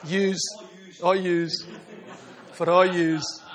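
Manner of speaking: Scottish "yous" with a long open "all"; Scottish "For all yous", broad and even. Shown as a demonstration. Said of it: "for our Scots".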